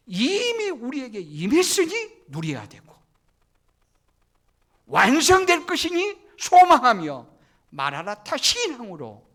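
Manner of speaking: loud, high, ringing sermon climax; fiery preacher crescendo; preaching at full force. Acted. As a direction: proclaim it to the whole church.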